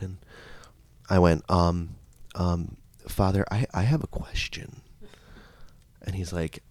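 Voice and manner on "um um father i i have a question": soft, halting, lightly acted timidity; tentative question with long pauses; hesitant child. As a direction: ask quietly, stumbling a little. Acts hesitant child to priest.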